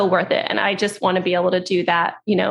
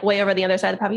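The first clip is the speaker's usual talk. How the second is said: ordinary delivery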